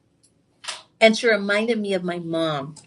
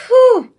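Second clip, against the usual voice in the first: loud, high sigh of relief; big relieved whew at good news; 13 semitones higher, 10 dB louder than usual. Real, not acted. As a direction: let out the relief. Relief at clear mammogram.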